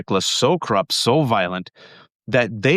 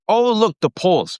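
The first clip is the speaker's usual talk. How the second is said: higher, bright-eyed mock cheer; mock optimism; 7 semitones higher, slower than usual. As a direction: parody naive hopeful people. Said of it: "you heard people say".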